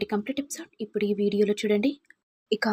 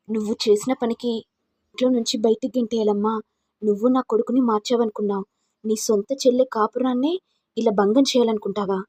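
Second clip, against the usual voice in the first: ordinary delivery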